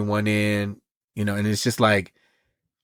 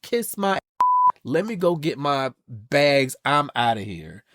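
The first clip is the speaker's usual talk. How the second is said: mock outrage; 5 semitones higher than usual. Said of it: "I'd have been like".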